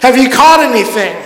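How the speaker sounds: calling out across water. Retold or imitated. Jesus to fishermen in the boat, calling out. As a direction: call out to friends far off. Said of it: "he calls out to them".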